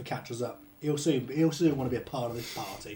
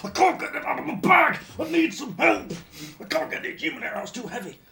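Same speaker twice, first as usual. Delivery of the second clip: whisper shouting; 8 semitones higher than usual. Acted. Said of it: "whisper shouting at Thorin".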